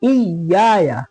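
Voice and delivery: इय्याया with the doubled y pressed firmly; hard doubled y. Shown as a demonstration. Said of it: "सख्ती".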